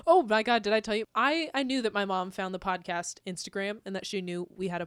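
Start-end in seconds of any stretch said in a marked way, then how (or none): none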